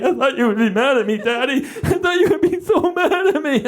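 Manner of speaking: hammed-up kid crying, rising in pitch; mock sobbing child. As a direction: plead like a bawling little girl. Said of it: "sobbing so badly".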